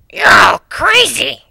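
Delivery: very high, loud, drawn-out; outraged yell. Acted. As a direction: yell it in disbelief. Outraged shout at plan.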